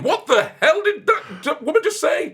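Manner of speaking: laughing mock outrage. Parents imagining the DJ's swear, laughing mock outrage. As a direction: squeal it out laughing as a shocked parent.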